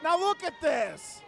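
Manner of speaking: loud, slow outraged exclaim; outraged shout. Real, not acted. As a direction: demand they look, incensed. Outraged at referee.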